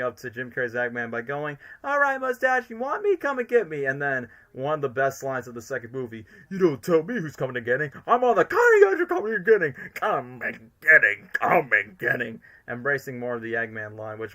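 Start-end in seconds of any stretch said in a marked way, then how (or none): 1.8-3.8 high-pitched cocky taunt; high, goading challenge; Sonic taunt. Retold or imitated. 6.5-9.7 hammed-up villain rant, rising louder; theatrical villain voice, climbing to a shout; Eggman. Imitates Jim Carrey's Eggman line. 9.9-12.4 slow, loud villain relish; drawn-out, hammy villain chant; Eggman. Retold or imitated. Imitates Eggman's repeated line.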